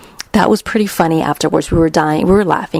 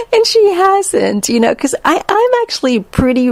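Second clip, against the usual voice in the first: laughing; 10 semitones higher than usual. Real, not acted. Still laughing while explaining.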